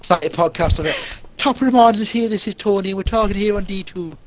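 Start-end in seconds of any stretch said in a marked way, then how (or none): 1.4-4.2 a lightly put-on Irish accent, a little higher; breezy stage-Irish radio intro, pitched up a touch; mock Irish accent. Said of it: "I wanna hear your Irish accent".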